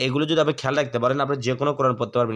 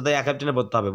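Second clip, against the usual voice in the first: ordinary delivery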